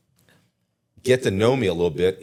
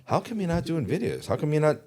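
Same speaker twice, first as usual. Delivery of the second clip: higher-pitched retold question to agents; puzzled questioning; 7 semitones higher, 4 dB quieter than usual. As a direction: ask it high, lightly prodding. Asking agents, acted as a question.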